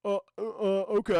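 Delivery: stammering, caught off guard; hesitant. Retold or imitated. Killer answering the agent at his door, hesitant. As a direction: stall with uneasy little uhs.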